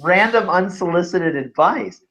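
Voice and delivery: playfully baffled at a stranger's pitch; mock disbelief. Retelling his reaction to a stranger's email.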